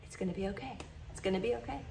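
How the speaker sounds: soft, soothing quoted dad; gently reassuring, like a calm father; imitating dad's soft reassurance. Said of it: "he'd be like".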